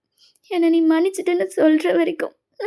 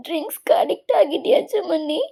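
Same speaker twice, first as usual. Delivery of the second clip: usual speaking voice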